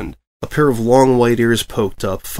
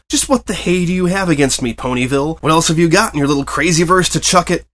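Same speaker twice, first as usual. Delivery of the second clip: pent-up rage; 6 semitones higher, 3 dB louder than usual. Acted. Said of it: "trembled with pent-up rage".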